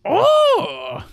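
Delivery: sudden high "oh"; high cry. Real, not acted. Player startled while playing with Jar Jar, high cry. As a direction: yelp at a surprise.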